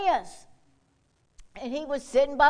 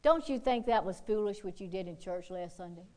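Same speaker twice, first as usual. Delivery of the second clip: sly tempter; 6 semitones lower, 8 dB quieter than usual. Retold or imitated. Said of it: "He'll send the right person".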